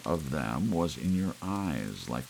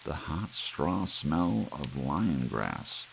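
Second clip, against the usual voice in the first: ordinary delivery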